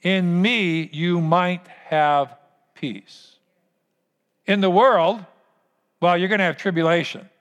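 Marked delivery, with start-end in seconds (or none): 0.0-3.4 slow repeat. Shown as a demonstration. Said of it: "say that with me".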